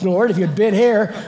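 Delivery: loud, high outburst through laughter; hammed-up complaint, laughing as you say it; mock reproach. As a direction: blurt it out loudly, half laughing. Mimics Martha's complaint amid laughter.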